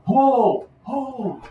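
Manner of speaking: high, drawn-out call to halt; yelling. Acted. Crowned leader yelling to stop his people, high yell. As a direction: call out high, first loud, then pulled back. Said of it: "he's yelling".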